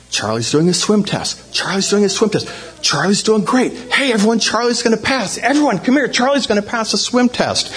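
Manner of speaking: starts level, climbs to high urgent calls; spreading excitement. Retold or imitated. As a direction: pass on the news, building in pitch like a growing crowd. Acts word spreading among excited staff.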